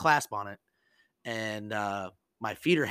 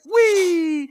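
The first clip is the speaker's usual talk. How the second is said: a joyful squeal, much higher and louder; gleeful whee; 9 dB louder than usual. Retold or imitated. Airman at helicopter takeoff, squealing high and loud with glee. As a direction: let out a giddy kid-on-a-ride whee. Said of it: "And I was very excited".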